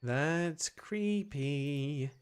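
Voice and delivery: ordinary talk, nothing marked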